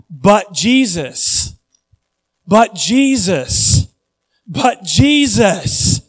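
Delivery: "But Jesus" drawn out slowly, pitch climbing with each repeat; building emphasis. Real, not acted. Preacher repeats the name, building.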